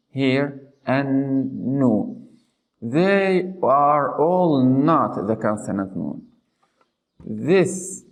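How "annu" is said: slow "annu" with a long nasal n; stressed nun. Shown as a demonstration. Teacher recapping from the beginning, "annu" with held n. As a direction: stretch and hum the double n of "annu". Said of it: "with stress or emphasizing".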